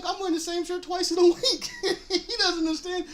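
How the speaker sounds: high voice, laughing through the words; laughing retelling. Retold or imitated. Retelling the friend's shrug, laughing. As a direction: crack up at how little he cares. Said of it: "And he's like".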